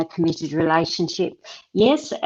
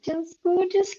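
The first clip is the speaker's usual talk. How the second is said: much higher, gushing over the card; delighted gush; 12 semitones higher than usual. Real, not acted. Reader gushes over the scarab beetle card, delighted. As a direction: squeal with delight at the pretty card.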